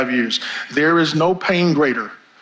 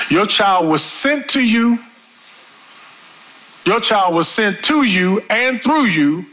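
"to you" and "through you" stressed, higher and slow; slow, emphatic point; 5 semitones higher than usual. Real, not acted. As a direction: raise the pitch and lean on "to" and "through". Preacher drives home a key point.

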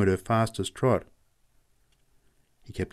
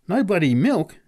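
much higher, louder amazed cry; exclaiming; 9 semitones higher, 6 dB louder than usual. Acted. Factory man amazed Pat brought no milk, loud exclamation. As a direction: cry out in disbelief. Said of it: "exclaimed the man".

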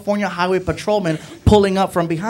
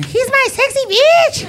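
high, slow squealing wife voice; wife's voice; 3 dB louder, slower than usual. Retold or imitated. Wife gushing over her husband, high and slow. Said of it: "His wife calls him Sexy".

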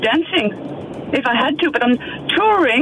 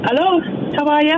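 put-on Irish accent; faster than usual. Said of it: "Jer, you've got an accent now".

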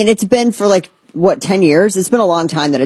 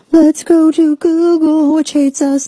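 high sing-song; 10 semitones higher than usual. Pretend. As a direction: sing it out sarcastically. Sarcastic aside, sing-song.

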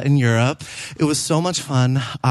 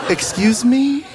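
shocked comeback; 8 semitones higher, slower than usual. Retold or imitated. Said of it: "I was like".

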